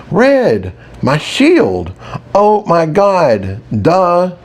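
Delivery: high-pitched, exclamatory dawning realization; sudden realization. A narrator's reading. Narrator finally getting the obvious hint, excited realization. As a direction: burst out as it finally clicks.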